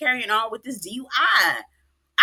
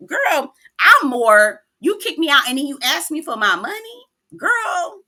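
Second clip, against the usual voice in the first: outraged; 6 semitones higher, 5 dB louder than usual. Real, not acted. Host scolding Ashley for asking for money, outraged. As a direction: call her out, incredulous.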